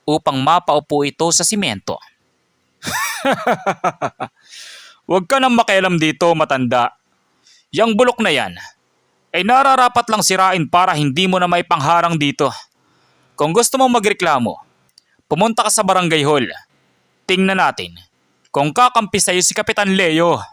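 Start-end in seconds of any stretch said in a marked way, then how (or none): 2.8-4.3 high mocking villain laugh; bully's scornful laugh. Tanod mocks the fallen man. 5.1-20.4 a hint of an arrogant village guard; taunting tanod. Tanod taunts the old man.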